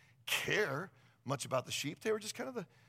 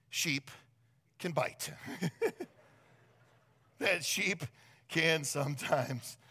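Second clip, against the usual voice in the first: normal talking voice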